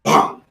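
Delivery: one loud, low mouth-made gunshot bang; a lone gunshot down the street, loud and low; single gunshot. Retold or imitated. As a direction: punch out a single pop of a gun. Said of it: "and you hear".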